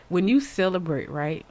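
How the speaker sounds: normal talking voice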